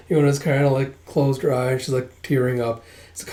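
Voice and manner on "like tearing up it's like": ordinary delivery, nothing marked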